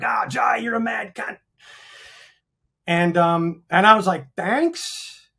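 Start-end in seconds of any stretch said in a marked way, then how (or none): 0.0-1.4 higher-pitched mock Aussie shout; drunk Australian blokes. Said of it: "they were drunk as shit". 4.3-5.3 high, rising, questioning thanks; unsure thanks. Retold or imitated. Retold puzzled reply to rude compliment.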